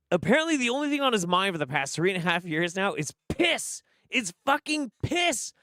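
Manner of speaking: high-pitched, exasperated bafflement; incredulous rant. Real, not acted. Worked-up rant about bizarre obsession.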